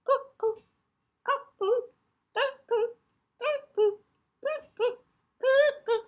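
very high, loud cuckoo calls; cuckoo clock. Acted. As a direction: sing out each cuckoo like the clock. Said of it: "cuckoo clock struck six".

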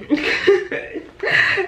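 breathy laugh. Real, not acted. Laughs about sleeping.

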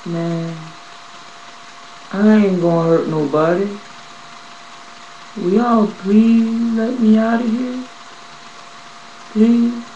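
high, slow plea; pleading. Retold or imitated. Strapped in the chair, begging the staff, pleading.

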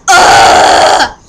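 low loud annoyed groan; exasperated sigh. Retold or imitated. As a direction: groan before answering. Respuesta exasperada.

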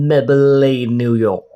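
a slow, drawn-out mock American ad accent. Shown as a demonstration. Vlogger naming his eyebrow pencil brand, hammed-up in an American ad accent. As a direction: stretch Maybelline New York out like a Western commercial. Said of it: "พูดยังไงดีให้มันสำเนียงได้นะ".

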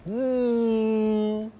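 loud high buzzing engine sound; buzzing plane noise. Acted. Teacher imitating a plane for the students. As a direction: buzz like a plane flying by.

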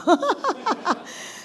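高而松口气的笑; 听到答案后松了口气，笑出声; relieved laugh. Real, not acted. Laugh after son's answer. (如释重负地笑)

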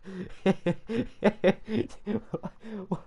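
high, quiet run of giggles; giggling fit. Real, not acted. Amused by how the model looks, high giggles. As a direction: giggle helplessly for a few seconds.

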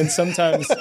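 says it through a laugh. Real, not acted. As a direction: giggle out the words. Laughing while repeating himself.

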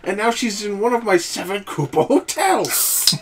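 high, loud hammed-up villain; villain letter voice. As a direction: gloat like a cartoon villain. Reading Bowser's letter in voice.

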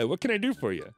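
plain reading voice

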